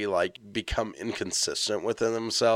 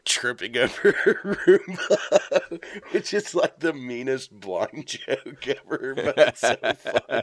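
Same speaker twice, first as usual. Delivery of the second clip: laughing; 4 semitones higher, 4 dB louder, slower than usual. Real, not acted. Laughing retelling a blind joke.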